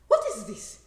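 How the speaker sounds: loud, high shocked "What is this?"; mother outraged at the sight; shocked mother's cry. Retold or imitated. Mother shocked at thin daughter.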